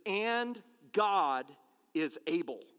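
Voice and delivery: And God is able, each word slow and weighted; stressed slow phrase. Shown as a demonstration. Pastor spelling out the key phrase, slow and weighty. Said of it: "the point that I want us to catch".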